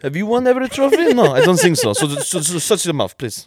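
high-pitched mock arrogant coach impression; Jose Mourinho. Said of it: "That's actually a really good impression of him".

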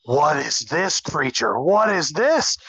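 bumpkin awe rising to high-pitched wonder; bumpkin amazement. Retold or imitated. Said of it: "Tanjiro are just such fucking bumpkins that they're like".

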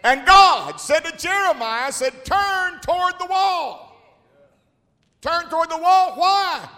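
loud, high preaching cry; preaching at full force. Acted. Preacher shouting the story to the congregation, loud preaching. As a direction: call it out to the whole church.

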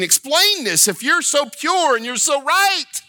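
high, indignant shouted challenge; shouting at God. Acted. Preacher as Habakkuk demanding God explain, shouting. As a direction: hurl it up at heaven as an accusation. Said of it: "He's shouting at God".

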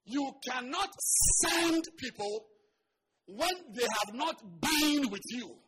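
loud, high preacher's cry; full-force preaching. Real, not acted. Preacher exhorting his congregation, loud and high. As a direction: drive the point home to the crowd.

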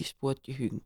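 usual speaking voice

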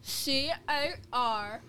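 spelled out letter by letter; slow, careful letters; slow careful spelling. Shown as a demonstration. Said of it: "try it one more time".

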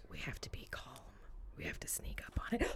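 hushed, voiceless planning; stealthy whisper. Real, not acted. Planning to sneak up on the spider, whispering. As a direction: whisper so as not to startle it.

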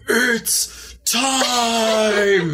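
a hint of the big fight intro; mock announcer call; Bruce Buffer announcement. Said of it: "In the words of Bruce Buffer".

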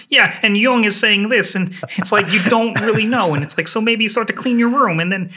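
high, tense lecturing voice; Jordan Peterson impression. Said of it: "That is scarily good".

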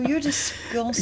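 high-pitched playful revulsion; disgusted reaction. Retold or imitated. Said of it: "I'm like".

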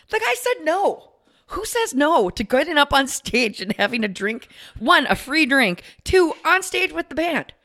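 high-pitched indignant disbelief; incredulous. Real, not acted. Ranting to co-host about the guy who refused, incredulous.

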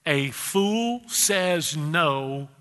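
stretched out slowly, NO held long; real slow. Shown as a demonstration. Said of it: "I'm gonna say that real slow".

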